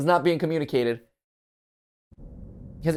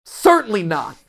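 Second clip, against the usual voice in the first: mock accusing; 5 semitones higher, 6 dB louder, slower than usual. Jealous wife mocking the evidence.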